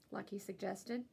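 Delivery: ordinary delivery, nothing marked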